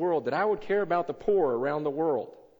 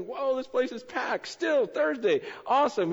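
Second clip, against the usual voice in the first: excited; 10 semitones higher, 5 dB louder than usual. Retold or imitated. Congregation packed at dawn.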